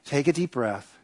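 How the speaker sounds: soft, quiet reassurance; calming wife. Retold or imitated. Wife calms her panicking husband, soft. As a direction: soothe him gently. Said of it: "She says".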